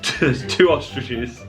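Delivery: ordinary talk, nothing marked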